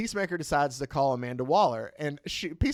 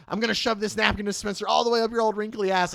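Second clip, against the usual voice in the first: fast, raised-pitch mock panic; panicked threat; 7 semitones higher, 3 dB louder, faster than usual. Cornered bluffer threatens the old general, panicking. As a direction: blurt the threat in an acted panic. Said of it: "then he panics and he goes".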